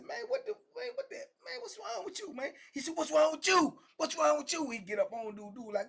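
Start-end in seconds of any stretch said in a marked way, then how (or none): 0.0-2.5 squeaky falsetto, softer than usual; shocked protest. Retold or imitated. Man whose food was smacked away protests, shocked falsetto. 2.9-4.7 high, forceful mock challenge; angry comeback. Bully fires back at the man he smacked, angry challenge. Aggressor throws the question back.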